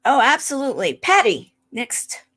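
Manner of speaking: ordinary delivery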